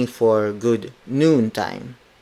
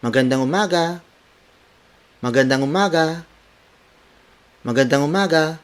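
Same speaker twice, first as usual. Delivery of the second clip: higher, run-together cheery greeting; 5 semitones higher, faster than usual. Shown as a demonstration. Teacher showing learners the intonation of good morning, cheery. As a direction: greet cheerily with a lifted pitch. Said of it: "make it a cheery tone".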